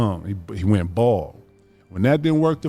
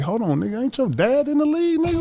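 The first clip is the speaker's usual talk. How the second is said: high, disbelieving question; mock surprised. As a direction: catch him out, amazed. Retold surprised question to Kobe.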